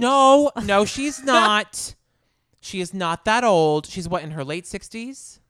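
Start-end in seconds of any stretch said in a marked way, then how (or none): 0.6-1.9 slow drawn-out no. Real, not acted. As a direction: stretch the denial out slowly. Drawn-out denial.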